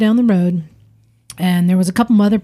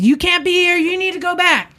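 angry gunman; 10 semitones higher than usual. Retold or imitated. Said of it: "And, and he's".

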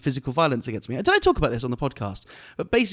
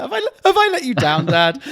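much higher, louder whiny mock child; mock hurt son; 10 semitones higher, 6 dB louder than usual. Comedian plays son to heckler as dad, mock wounded child voice. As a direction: play the wounded son, hammed-up.

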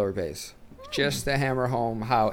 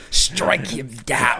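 Emperor Palpatine; much slower than usual. Acted. Palpatine quote, laughing.

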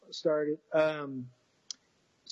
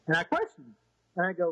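normal talking voice

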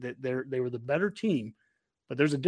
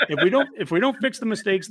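laughing; 6 semitones higher, 5 dB louder than usual. Real, not acted. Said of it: "You're killing me, Honky".

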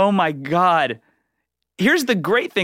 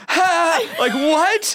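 startled relieved laugh; 12 semitones higher, much slower than usual. Retold or imitated. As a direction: yelp it out like a weight just lifted. Relief, she's not pregnant.

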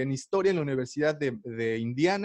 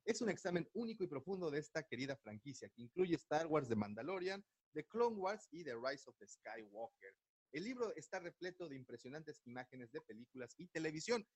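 distant, faint voice off mic; far from mic; 16 dB quieter than usual. Real, not acted. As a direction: sounds far from the microphone. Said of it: "Te oyes como lejos".

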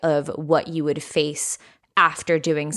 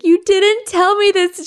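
high, indignant "you didn't tell me this"; mock shocked; 13 semitones higher, 5 dB louder, slower than usual. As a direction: play up the betrayed surprise, pitched way up and louder. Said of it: "I'm like".

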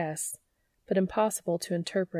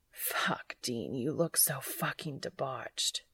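breathless, whispered gasp, softer; 5 semitones lower, 7 dB quieter, slower than usual. Acted. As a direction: gasp it out on the edge of climax. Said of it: "Cass gasped".

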